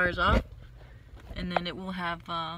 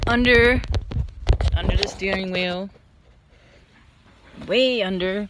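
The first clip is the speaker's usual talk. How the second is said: louder, stressing way under; loud emphatic pointing; 3 semitones higher, 10 dB louder than usual. Real, not acted. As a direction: call out where it is. Pointing under the steering wheel.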